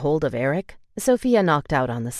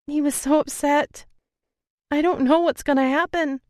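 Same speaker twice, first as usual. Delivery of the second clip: much higher, strained near-sobbing; holding back sobs; 12 semitones higher than usual. Acted. To her brother about Eric, holding back sobs. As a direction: fight the tears and lose. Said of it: "holding back another wave of sobs".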